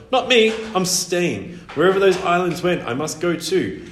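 high, loud insistence; defiant. A narrator's reading. Boy determined to follow his parents, defiant.

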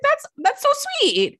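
very high, slow, fake-sweet voice; Teresa. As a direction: imitate a bad actress gushing. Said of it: "you're the worst actress".

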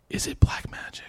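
a hushed, superstitious whisper. Retold or imitated. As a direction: whisper it like a secret. Friend's fearful question retold.

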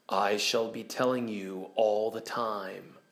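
low, soft, slow lion's voice; hushed, measured storybook lion; Aslan's voice. Acted. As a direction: answer slowly and quietly, down low. Aslan answering the children.

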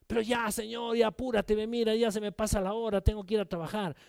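mock-anxious hurrying; impatient pleading. Abraham urging God to hurry, playfully impatient. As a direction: press God to get on with it.

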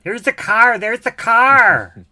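high, loud Minnesota shout; Frances McDormand. Retold or imitated. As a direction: yell it like you just spotted the car. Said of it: "Frances McDormand in Fargo".

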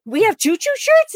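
can't believe the shirts exist; delighted surprise. Retold or imitated. Mariner voiced, surprised question.

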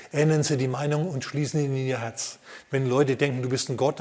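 ordinary delivery

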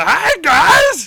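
high, loud strained shout; Bobcat Goldthwait voice. Retold or imitated. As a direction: squeal it out high. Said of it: "Bobcat's got the".

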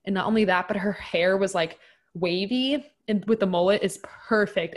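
usual speaking voice

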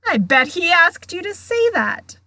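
high, loud jeering yell; boisterous, accusing shout in a character voice. A narrator's reading. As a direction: heckle at full voice. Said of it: "yelled Sir Patrick's head".

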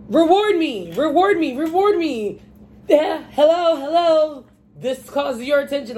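high, loud nagging voice; demanding people. Retold or imitated. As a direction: pester loudly for attention. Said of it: "everybody is pecking at you".

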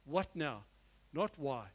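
lightly voiced Jesus, low and measured; calm voice of Jesus. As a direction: speak as Jesus would, unhurried and steady. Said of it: "is he said".

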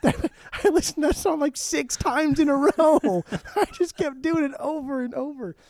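high-pitched, laughing disbelief; excited gushing. Real, not acted. Fan confessing repeat plays to cohost, excited and laughing. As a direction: squeal it out through real laughter.

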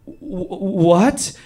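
high, halting stammer rising to a question; stunned stammer. Retold or imitated. Storyteller facing his raging friend, acted stunned stammer. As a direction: act dumbstruck, stuck on the first sound.

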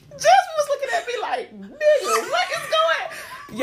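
high, loud, laughing mock quote; quoting him while cracking up; laughing imitation. Imitating Chad's stare while laughing loud.

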